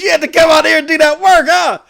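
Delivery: high, amused heckle; imitated teasing firefighter. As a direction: tease a neighbor, quick and high. Firefighter teasing him, storyteller laughing.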